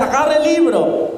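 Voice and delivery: high, urgent command. Retold or imitated. The tempting voice urging the man, high and pressing. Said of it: "Y decía".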